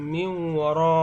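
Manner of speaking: "মিন ওয়ারা" with the n merged into a long nasal w; ghunnah recitation. Shown as a demonstration. Teacher showing how it is joined, nasal chant. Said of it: "এরকম".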